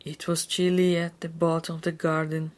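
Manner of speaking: usual speaking voice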